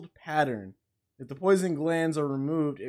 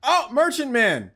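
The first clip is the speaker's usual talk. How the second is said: calling out; 8 semitones higher, 5 dB louder than usual. Real, not acted. Greets the merchant loudly.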